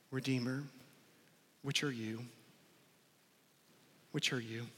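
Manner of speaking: hushed, searching question to the hearer; soft appeal. Real, not acted. Preacher quietly asking the congregation, hushed.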